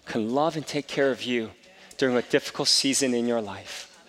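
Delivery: normal talking voice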